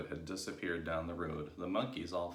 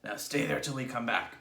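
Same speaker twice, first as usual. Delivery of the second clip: raised, commanding voice; stern order; 5 semitones higher, 6 dB louder than usual. A narrator's reading. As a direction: order them to stay put. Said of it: "Mister Twit ordered".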